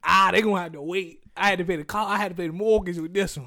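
loud, put-upon complaint; mock exasperated rant. Acting out telling off family.

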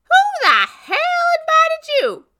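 high, loud indignant outburst; sharp, high-pitched angry challenge; prickled. A narrator's reading. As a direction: snap back, bristling at an unwelcome guest. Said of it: "She prickled".

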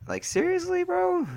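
very high, incredulous squeal; squeaky disbelief. Real, not acted. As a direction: push the question way up into a squeak. Exasperated disbelief at the result.

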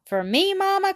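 put-on high-pitched, eager little girl's question; eager cry. A narrator's reading. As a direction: pipe up hopefully as an eager child. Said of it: "cried the little girl".

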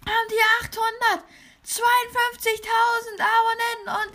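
over-the-top YouTuber excitement. Acted. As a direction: keep it high and thrilled. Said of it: "mit einer höheren Stimme geredet".

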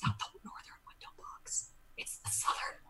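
hushed whisper correcting himself; whispered aside. Real, not acted. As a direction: whisper it quickly under your breath.

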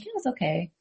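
very soft, unimpressed monotone; mock underwhelmed mom, barely audible; imitated monotone. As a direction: say it with hushed, deadpan indifference. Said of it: "she would be very monotone".

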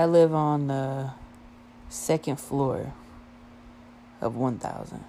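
slow, dropping to near quiet on each phrase. Retold or imitated. Telling a girl at the desk where he lives, slow and quiet. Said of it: "and that's how I said it".

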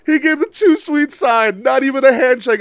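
high, loud insistence; excited shout. Real, not acted. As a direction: shout it down his disbelief. Insisting against friend's disbelief.

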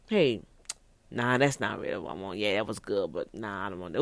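dismissive man. Acted. Man losing interest after sex, low and offhand. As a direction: brush her off casually.

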